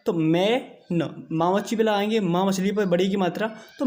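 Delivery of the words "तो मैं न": "मैं न" stretched very slowly; slow phonics. Shown as a demonstration. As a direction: sound out "मैं न" in two slow beats. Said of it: "इस ए की ध्वनि भी बढ़िया की निकलेगी". ("मैं न" को बहुत धीरे दो हिस्सों में बोलना)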